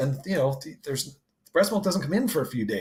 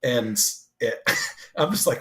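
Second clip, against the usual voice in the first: normal talking voice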